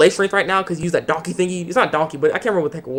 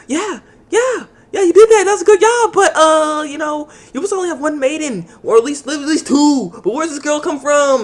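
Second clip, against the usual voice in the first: much higher, shrill mock-sweet voice; sing-song sarcasm dripping jealousy; jealous girls teasing; 11 semitones higher, 3 dB louder than usual. Jealous girls teasing Kusanagi Goto, high mock-sweet voice. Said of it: "and they're like".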